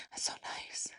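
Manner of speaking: breathy whisper, almost no voice; whispered aside. Real, not acted. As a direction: whisper it to yourself, touched. Soft aside about milk offerings.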